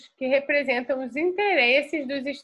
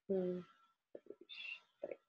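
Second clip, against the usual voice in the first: whispered count; 4 semitones lower, 18 dB quieter, much slower than usual. Real, not acted. Teacher counts in the class.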